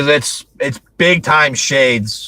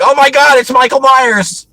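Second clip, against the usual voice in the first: mock terrified scream, much higher and louder; screaming bloody murder; 12 semitones higher, 5 dB louder than usual. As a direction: shriek the name in hammed-up terror. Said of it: "screaming bloody murder".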